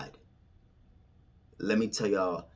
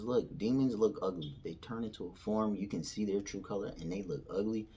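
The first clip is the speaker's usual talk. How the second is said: ordinary talk, nothing marked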